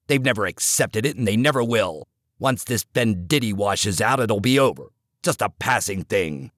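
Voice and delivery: low, hard coach voice cutting him down; stern older coach. Acted. Coach scolding the assistant in the dugout, low and hard.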